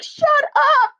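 retold shriek of disbelief; shocked disbelief. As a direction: jump way up high in mock disbelief. Retold disbelief at friend's ghost claim.

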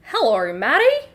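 lightly put-on Australian Gibbon, lifting at the end. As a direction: greet as a chirpy Australian monkey. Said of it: "absolutely need to do a voice".

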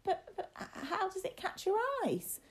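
put-on very high, stammering child voice; wobbling frightened boy. A narrator's reading. Boy asks about the demon, high voice wobbling with hidden fright. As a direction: stammer it, trying not to sound frightened. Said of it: "his voice wobbling with the effort".

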